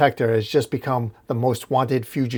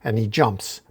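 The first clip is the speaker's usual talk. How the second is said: ordinary talk, nothing marked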